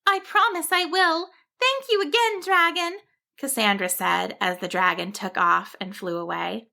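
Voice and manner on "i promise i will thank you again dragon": high, grateful sheep voice; a small sheep waves goodbye; little sheep. A narrator's reading. Sheep thanks dragon.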